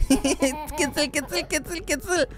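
high, soft tickle chant; light, high singsong teasing; tickling baby talk. Pretend. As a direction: coo it playfully to a baby.

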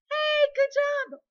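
high, loud and drawn-out praise; big cheer. Real, not acted. Teacher praising Adeline's spelling, high loud cheer.

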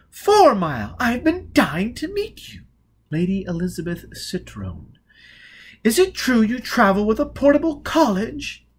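high and loud eager society voice; breathless with excitement to meet him; gushing young lady. Acted. Lady Elizabeth meeting Four Mile, gushing high.

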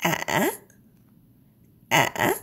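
A rises with a short break in the middle; A with a catch, then up; broken rising tone. Shown as a demonstration. Teacher demos the broken tilde tone. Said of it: "but there is a short break".

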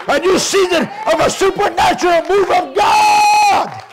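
fervent declaration building to a high shout; rousing revival preacher crescendo; full-force preaching. Real, not acted. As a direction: pile up phrases, climbing to a long final cry.

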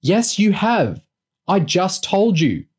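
high, drawn-out frustrated retort; exasperated. A narrator's reading. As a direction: stretch each word in disbelief at being ignored. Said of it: "growing increasingly exasperated".

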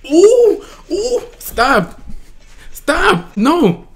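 very high, loud fangirl squeals; excited screaming. Real, not acted. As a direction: shriek it in overwhelmed excitement. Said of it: "I don't know why I'm screaming".

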